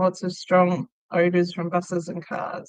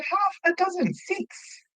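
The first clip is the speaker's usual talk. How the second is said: high-pitched, falling away softer at the end; mock-patient spelling out; exasperated repetition; 11 semitones higher, 6 dB quieter, slower than usual. Customer explaining an order to baffled staff, exasperated.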